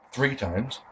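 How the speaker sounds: usual speaking voice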